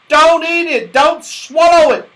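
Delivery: high, loud panicked yell. A narrator's reading. Moonface to Franny eating the cake, yelling in alarm. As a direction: bellow to stop her in time. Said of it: "yelled Moonface suddenly".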